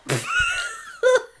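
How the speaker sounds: high squealing laugh; startled laughing shriek. Real, not acted. Mom squealing with laughter as the baby lands on her.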